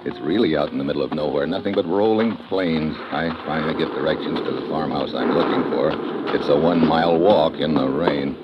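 low, even detective narration; a tired detective's voiceover; story narration. A narrator's reading. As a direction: tell it like a hardboiled private eye looking back. Hardboiled narration over music.

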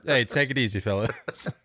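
lightly imitated casual sign-off; mimicking the caller. Host mocking a ranting caller's farewell, light imitation. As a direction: quote the caller's breezy goodbye, lightly put on. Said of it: "and then ends it with".

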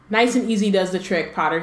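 ordinary talk

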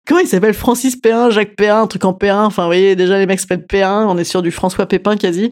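ordinary delivery

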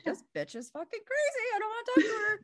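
high mocking voice of a judgy friend; imagined judgy friend. Imagining a friend's judgment, high and mocking. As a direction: squeak it out like she thinks you're nuts. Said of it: "her judging me and being like".

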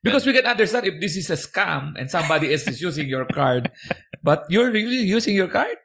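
high, slow mock customer service; imitated card agent. Agent warns cardholder of a possible scam, mock-careful. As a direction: draw it out like a careful, doubtful agent. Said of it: "they call and they're like".

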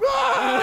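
sudden very high, loud fake wail; mock wailing scream. One-upping a crying girlfriend, mock wailing scream. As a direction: burst into a hammed-up scream. Acting out crying to outdo her.

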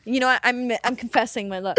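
ordinary talk, nothing marked

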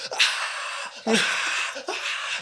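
voiceless pained ahs, one jumping high; breathy pained gasps. Retold or imitated. Patient gasping as the dentist touches the abscess, pained. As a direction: gasp out the pain in short bursts.

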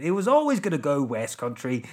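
put-on West Country accent. Shown as a demonstration. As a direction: sign off in comic West Country. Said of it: "feel much more secure doing this accent".

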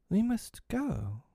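high, slow and light; mock cheer to hide the danger; almost brightly. Wendy telling Peter they must go, almost brightly. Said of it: "almost brightly".

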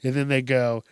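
usual speaking voice

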